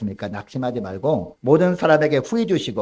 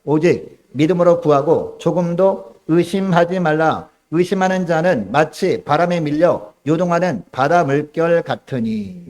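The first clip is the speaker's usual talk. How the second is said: slow, loud solemn recitation; scripture reading aloud; 3 semitones higher, 5 dB louder, slower than usual. Acted. Preacher leading the congregation in reading the verse, slow and solemn. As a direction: read the verse together with the congregation. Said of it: "육 절 말씀 함께 읽습니다".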